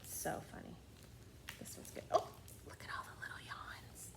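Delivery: soft hushed whisper. Real, not acted. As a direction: whisper over the pictures. Hushed remarks on pictures.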